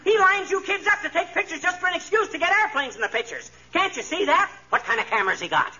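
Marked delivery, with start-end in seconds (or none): 0.0-4.5 high, urgent, worked-up insisting; excited insistence. Acted. Husband convinces the girls, high excited insistence. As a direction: hammer home the spy theory.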